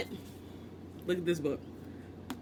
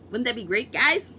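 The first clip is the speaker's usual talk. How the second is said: guys pushed higher and louder; mock hype, getting louder; building mock hype; 5 semitones higher, 5 dB louder than usual. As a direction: keep building the hammed-up hype. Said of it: "I don't know why I did that".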